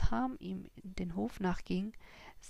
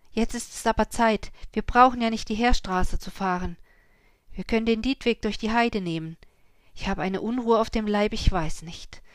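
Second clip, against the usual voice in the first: louder, higher, restless; uneasy urging; 4 semitones higher, 8 dB louder than usual. A narrator's reading. Ui urging the farmers to hurry, louder and uneasy. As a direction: press them to leave now.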